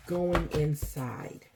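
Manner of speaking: slow, soft going inside; stretched, gentle repeat; slow emphasis. Shown as a demonstration. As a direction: let Going inside sink in slowly. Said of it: "repeated slowly".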